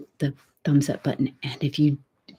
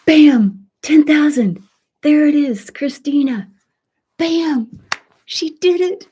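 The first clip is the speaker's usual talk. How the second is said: high, loud celebrating shout; real triumph; 9 semitones higher, 7 dB louder than usual. Real, not acted. Host celebrating friend's channel reaching ten thousand, ecstatic. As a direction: cheer the milestone for real.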